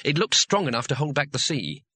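ordinary delivery, nothing marked

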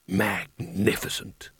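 low, soft and drawn out; awed murmur. A narrator's reading. Carding watches the boy's power, in a hushed voice. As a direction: breathe the word slowly in awe. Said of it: "he said instead".

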